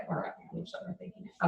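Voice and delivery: muttered under her breath; whispered aside. Real, not acted. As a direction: whisper it to yourself. Muttering to herself mid-instruction.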